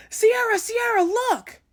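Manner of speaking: high, loud excited call of a name; loud excited shout upstairs. Retold or imitated. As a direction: call her name up high and stretched, thrilled. Sister bursts in calling out.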